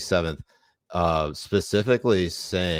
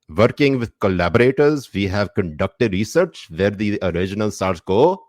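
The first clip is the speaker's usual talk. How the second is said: put-on reading in a light Pakistani accent; a hint of Pakistani accent, reading aloud; 4 dB louder than usual. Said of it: "I gotta do this in Pakistani accent".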